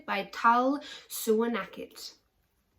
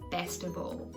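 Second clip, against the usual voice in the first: ordinary delivery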